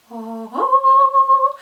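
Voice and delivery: high, loud, rising coo; delighted sung "oh". Pretend. Showing off a perfect new lipstick, delighted coo. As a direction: swoon in a long sung note.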